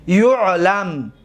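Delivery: یعلم said slowly as "yu'lam", opening on "u"; passive-voice form. Shown as a demonstration. Teacher demonstrates the passive to students, یعلم pronounced slowly "yu'lam". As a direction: draw out یعلم as "yu'lam", falling at the end.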